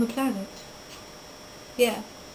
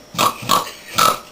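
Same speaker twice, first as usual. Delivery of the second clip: pig snort. Pretend. Playing animal noises as a pig, snorting. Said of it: "I'm a pig".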